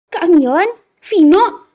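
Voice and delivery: alarmed cry of names; startled exclamation. A narrator's reading. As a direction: call both names, taken aback. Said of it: "Gadis cantik ini tersentak".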